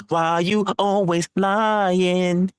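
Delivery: drawn out slow mocking taunt; slow teasing chant; slow sing-song taunt. Shown as a demonstration. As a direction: stretch every word like a schoolyard taunt. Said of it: "here's what I'm gonna say".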